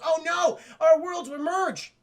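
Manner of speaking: high, loud drawn-out dismay; mock alarm. Luigi realizing the worlds will merge, loud alarm. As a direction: cry out the dire warning slowly.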